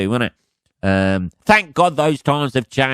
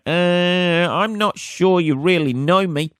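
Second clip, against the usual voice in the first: mock polite disappointment; 9 semitones higher, slower than usual. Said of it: "you look at it and you go".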